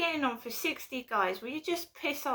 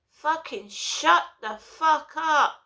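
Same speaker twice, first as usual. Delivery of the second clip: loud, higher, slow angry yell; furious shout; 5 semitones higher, 7 dB louder, slower than usual. Real, not acted. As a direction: snap at the noisy passengers. Ranting about noisy train passengers.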